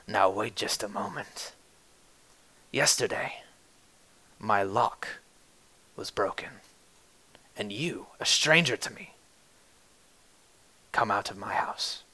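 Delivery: quiet menacing mutter. Acted. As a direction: mutter it so only he hears. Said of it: "he mutters under his breath".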